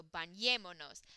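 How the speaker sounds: "bañémonos" with ÑE punched, then falling away; stress on the E. Shown as a demonstration. Teacher showing the accented vowel, strong stress on the é of "bañémonos". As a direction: hit the second syllable of "bañémonos" hard. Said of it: "Second to last vowel is this E".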